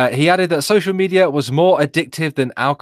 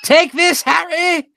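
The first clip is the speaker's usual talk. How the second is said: high-pitched mock-regal battle cry; hammed-up, shrill-pitched Queen impression; the Queen gaming; 5 dB louder, slower than usual. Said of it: "Can you see the Queen sitting".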